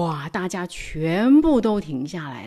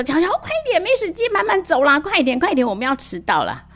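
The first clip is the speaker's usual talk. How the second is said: put-on high, urgent mom pushing her child; hurrying mother; 9 semitones higher, 3 dB louder, faster than usual. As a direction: hurry him along, we're late. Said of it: "然后呢，妈妈又说：". (妈妈催乔乔快点，高声急切地喊)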